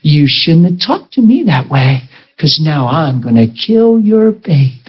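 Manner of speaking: hammed-up possessed woman; sinister demon voice. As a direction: menace the pregnant woman. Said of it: "this sinister look came on her face".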